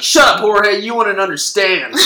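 loud, high dismissive snap; hammed-up scornful gunslinger; mock brush-off. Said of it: "He's like".

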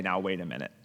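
hushed, humbled realization; humbled pause. Pretend. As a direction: say it low, as if caught out yourself. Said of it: "You should say".

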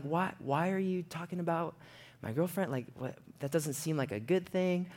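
soft, puzzled imitation; hurt bewilderment. Buddy hearing about his girlfriend, soft bewildered questioning. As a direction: ask it quietly, taken aback. Shocked buddy retold, voice softer.

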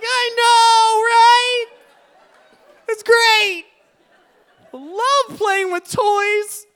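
very high, loud comic wail; hammed-up bawling through happy words; mock crying. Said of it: "both just hugging each other, crying".

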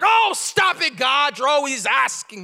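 loud, high playful protest; loud high mock-exasperated cry; exasperated shout. Real, not acted. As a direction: shout it half laughing. Exasperated at God's questions.

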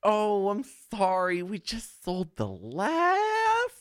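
high, very slow, drawn-out apology; mock hyper barista. Barista apologizing for the coffee, high, slow and syrupy. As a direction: croon it like an overbright barista. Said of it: "And he's like".

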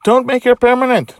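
put-on high, loud child-character protest; urgent high-pitched outburst; loud plea. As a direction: plead at the top of your voice. Charlie pleads in the story.

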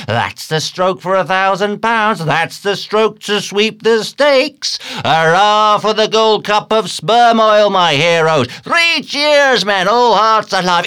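high, building to loud hurrahs; rally the rowers like a roaring coxswain; exultant high shouting; excited cheering. A narrator's reading. Stubb cheering on his rowers.

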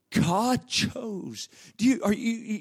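ordinary delivery

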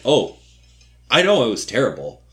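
loud, quick, rueful admission; mock embarrassment. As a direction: blurt it out fast and loud, cringing. [name] answering about her singing.

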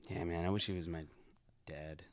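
quiet, wistful longing. Real, not acted. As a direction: drop low and soft, wishing. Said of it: "a real moment here?".

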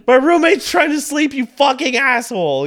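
high, loud insulting shout; heated yelling. Retold or imitated. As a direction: spit the insult at her. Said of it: "I got real heated with her".

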